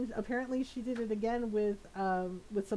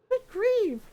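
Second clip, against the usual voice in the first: exasperated; 10 semitones higher, 6 dB louder than usual. Retold or imitated. Steph reacting to baby's gulping.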